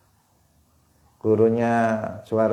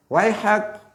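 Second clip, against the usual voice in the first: mock rebuke; 10 semitones higher than usual. Companion rebukes the loud Bedouin.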